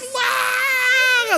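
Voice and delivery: long, high, held wail; mock bawling; imitated child crying. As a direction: bawl like a child in one drawn-out cry. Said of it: "dia nangis".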